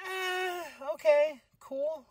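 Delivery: high, drawn-out noncommittal reply; unconvinced reaction. Retold or imitated. Host reacts to the debut match news, high and unconvinced. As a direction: stretch it out high, clearly not sold.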